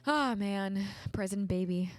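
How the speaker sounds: normal talking voice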